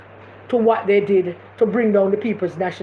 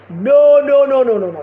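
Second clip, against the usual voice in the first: loud, insistent string of no's; emphatic no; 2 semitones higher, 5 dB louder than usual. Real, not acted. To the live audience, refusing Golding as leader, loud insistent no. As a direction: shut the idea down hard, no no no.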